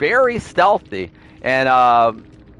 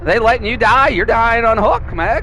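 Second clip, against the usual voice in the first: mock concern; 5 semitones higher than usual. Killer taunting a hooked survivor.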